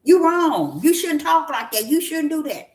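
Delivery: high, scolding voice of the critics; lightly mocking scold. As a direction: wag a finger at me in mockery. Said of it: "They look at you".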